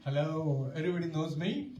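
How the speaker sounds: usual speaking voice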